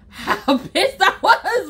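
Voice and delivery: slow, laughing through pissed. Real, not acted. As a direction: laugh mid-word, drawn out. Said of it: "I don't even think that it could really express".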